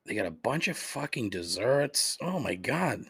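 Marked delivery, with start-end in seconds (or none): none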